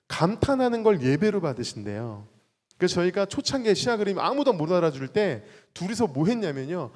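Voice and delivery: ordinary delivery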